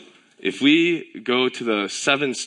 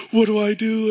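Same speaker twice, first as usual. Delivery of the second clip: higher, louder panicked cry; a burst of retold panic over the pinched finger; 11 semitones higher, 4 dB louder than usual. Father retelling his panic over his injured daughter, higher and louder. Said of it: "And I freaked out".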